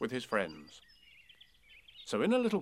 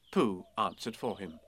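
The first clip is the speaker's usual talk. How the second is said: ordinary delivery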